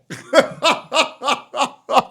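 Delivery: high, drawn-out staccato cackle; exaggerated laugh at a silly argument; pulsing high-pitched mock laughter. Mocks a chatter's Omega Lol argument.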